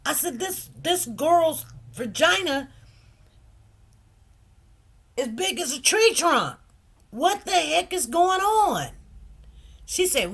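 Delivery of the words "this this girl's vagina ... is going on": shocked outburst. Retold or imitated. Retold outburst to a colleague.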